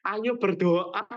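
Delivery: slow, drawn-out mock cheer; very slow, sing-song nagging; mocking routine exhortation. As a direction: parody a tired community slogan. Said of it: "Jangan cuma tentang".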